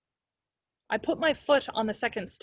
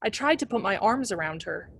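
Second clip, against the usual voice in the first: plain reading voice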